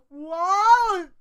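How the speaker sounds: high, loud, drawn-out disbelief; what, stretched in confusion; baffled. Real, not acted. Reader baffled by the alien's name, incredulous.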